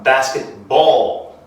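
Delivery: slow, careful basketball. with each syllable drawn out; slow model word. Shown as a demonstration. Teacher modelling the word slowly for the class to repeat. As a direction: stretch basketball. out for learners to copy. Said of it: "Everybody say".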